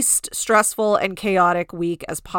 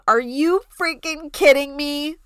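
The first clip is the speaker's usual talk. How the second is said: high, indignant mock outburst; Shannon Beador outraged; 8 semitones higher, 4 dB louder than usual. Host imitating an ex's angry reaction, high mock outburst. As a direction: squeal it out in disbelief, high. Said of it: "you can hear Shannon Beador right now saying".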